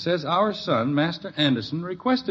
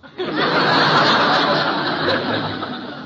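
high breathy burst of laughing; burst of laughter; 7 dB louder than usual. Acted. Father laughs at son's dodge, bursting into high laughter. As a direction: laugh out loud, caught off guard.

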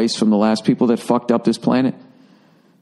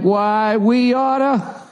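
high, very slow cartoon bully; higher tough-guy threat; 8 semitones higher, much slower than usual. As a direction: stretch it higher and slower, mocking. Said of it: "China's like".

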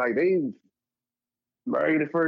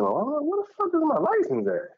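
high, alarmed question; suddenly can't find it; panicked search; 13 semitones higher than usual. Retold or imitated. Man searching for his license before going out, high alarmed. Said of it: "I'm like".

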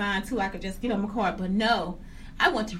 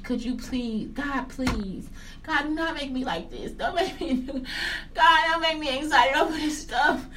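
mock pleading prayer; 4 semitones higher than usual. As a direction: beg God, half laughing, building up. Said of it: "Sometimes I was like".

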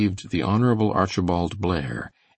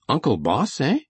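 raised, curious query; sudden interest; 7 semitones higher than usual. A narrator's reading. John asks about the boss's uncle, suddenly interested. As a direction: check it with new interest. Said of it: "said John with sudden interest".

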